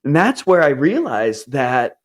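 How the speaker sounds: ordinary talk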